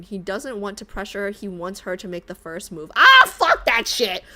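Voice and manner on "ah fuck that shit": loud, slow scoff; loud dismissal. Real, not acted. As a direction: throw it away with loud contempt. Rejects character's reasoning.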